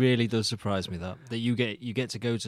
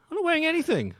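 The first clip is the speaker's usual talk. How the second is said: squeaky mock claim; faster than usual. Teasing co-host, squeaky claim.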